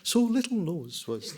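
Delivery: high, slow storyteller impression; imitated children's-TV reader, slow and high; John Grant. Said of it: "without doing John Grant's voice".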